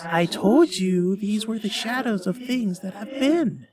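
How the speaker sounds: high, eerie, otherworldly spirit; ghostly voice. Acted. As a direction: speak as a solemn ghost from far away. Ghost speaks, narrator voices it.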